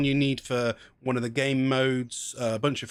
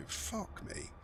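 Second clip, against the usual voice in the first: muttered curse; 6 semitones higher, 14 dB quieter than usual. Real, not acted. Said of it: "swears under breath".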